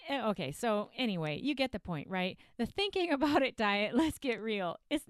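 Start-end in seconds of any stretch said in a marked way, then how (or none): none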